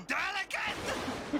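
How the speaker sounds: gasp, then a slow indignant echo; offended gasp. Acted. Vampire offended at being called delicate, gasping indignant echo. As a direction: repeat the insult slowly, scandalized.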